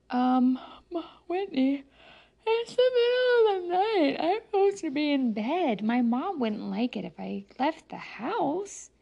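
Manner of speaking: put-on sleepy boy, unsure about sneaking out; boy's voice. Stephen's voice in the story.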